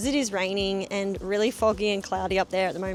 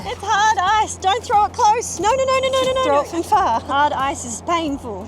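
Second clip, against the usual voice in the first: shouting; 11 semitones higher, 9 dB louder than usual. Real, not acted. Pleading in snowball fight.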